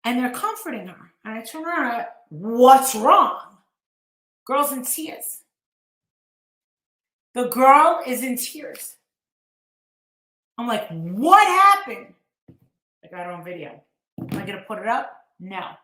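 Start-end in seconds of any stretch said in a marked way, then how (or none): 2.3-3.6 called out. Retold or imitated. Asking the crying girl. 10.9-12.1 slow, loud, stretched-out alarmed question. Retold or imitated. Reporter asking the sobbing girl, slow and loud.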